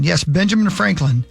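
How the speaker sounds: normal talking voice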